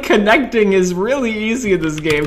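ordinary delivery

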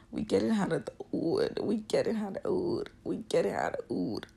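put-on loud, high Yoruba accent prayer. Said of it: "I use my Yoruba accent".

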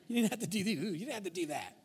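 ordinary delivery

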